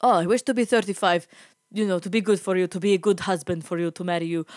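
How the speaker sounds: mimicked wistful old suitor, accented; creepy old man. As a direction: replay the old driver's broken-English wishing. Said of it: "this creepy old man".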